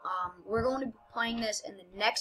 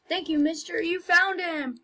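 put-on grateful kid voice; 8 semitones higher, 5 dB louder than usual. Voicing grateful NPC kid.

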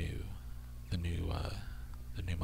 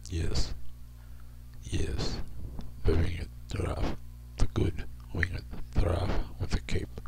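whisper; 3 dB quieter than usual. Real, not acted. Musing about winged giraffe.

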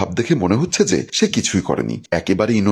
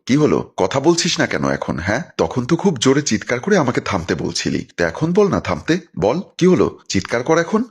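usual speaking voice

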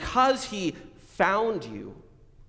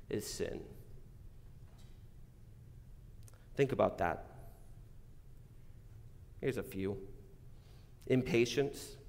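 soft pastor's call to reflection; reflective prayer prompt; 3 semitones lower, 5 dB quieter than usual. Acted. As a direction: guide them inward, softly. Quiet reflective prompting for self-examination.

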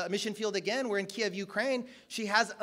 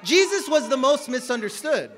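loud, driving sermon voice; loud preaching to the congregation; preaching loudly; 4 semitones higher, 9 dB louder than usual. Acted. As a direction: press the point home loudly. Preaching climax.

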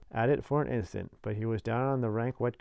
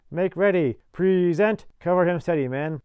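loud, high commanding call; shouted military orders; 8 semitones higher, 10 dB louder than usual. A narrator's reading. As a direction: call out orders to a firing party. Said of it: "hears the orders".

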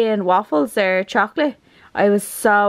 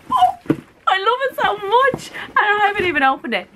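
high, rushed excitement; giddy gushing; 11 semitones higher, faster than usual. Real, not acted. Vlogger about to open the box, giddy. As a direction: rush it out, bursting with glee.

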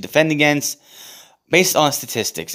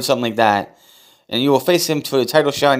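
ordinary talk

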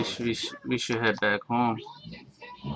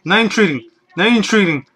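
panicked shout, much louder and higher; terrified horror victim; 6 semitones higher, 9 dB louder than usual. Real, not acted. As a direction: yell it in total panic. Retracts calm remark, screaming.

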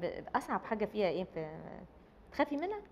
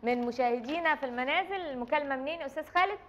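playful TV host; 5 semitones higher than usual. Pretend. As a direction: play a call-in host with mock cheer. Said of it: "mock TV host taking call".

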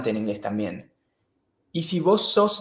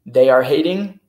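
"They are hating" called out much louder; wrong form, loud; 11 dB louder than usual. Shown as a demonstration. (grita "They are hating" como forma incorrecta)

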